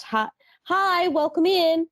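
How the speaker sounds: high, bright retail greeting; chirpy greeting. Shown as a demonstration. Clerk greeting every customer, high cheery voice. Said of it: "It's just".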